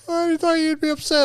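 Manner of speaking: put-on the kid surprised by dad's calm; son's voice.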